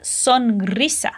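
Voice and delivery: sonrisa with a clear S, not Z; careful teaching model of sonrisa; sonrisa with separated sounds. Shown as a demonstration. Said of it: "Cada letra tem um som bem separado".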